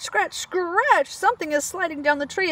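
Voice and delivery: plain reading voice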